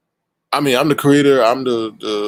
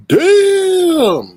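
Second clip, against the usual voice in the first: much higher, louder burst of surprise; shocked exclamation; 8 dB louder than usual. Real, not acted. Host seeing the Gollum picture, shocked shout. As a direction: recoil at the picture.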